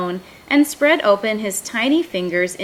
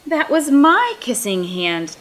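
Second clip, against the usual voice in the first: mild hurt gasp, stress on my; a touch of heartbreak, the word my pressed; saddest voice; 5 semitones higher, 3 dB louder than usual. A narrator's reading. Chester to mother, seeing his brother kissed, mildly hurt. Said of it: "in the saddest voice his mother had ever heard".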